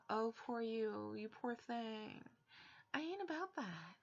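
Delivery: ordinary delivery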